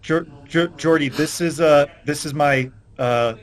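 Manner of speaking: put-on flustered young man. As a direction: fumble through introducing his dad. Player voices Garrett introducing.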